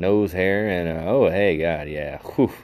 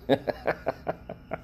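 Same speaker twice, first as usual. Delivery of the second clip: high airy wheeze of a laugh; wheezy giggle; 8 semitones higher, 7 dB quieter than usual. Real, not acted. Host laughing at his own gross joke, airy giggle. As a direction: giggle without voice.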